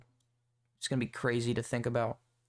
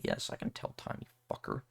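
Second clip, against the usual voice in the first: whispered, much softer comeback under the breath; muttered retort; 8 dB quieter than usual. Retold or imitated. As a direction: hiss the comeback quietly. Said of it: "And I'm like".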